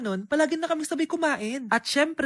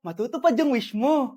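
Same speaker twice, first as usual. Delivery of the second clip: ordinary talk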